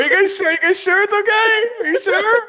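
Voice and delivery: mock anxious pleading. Pizza guy calling to the people in the house, mock anxious.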